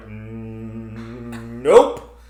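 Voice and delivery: terrified loud nope. Retold or imitated. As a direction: snap out of it with a loud final no. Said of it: "I'm like".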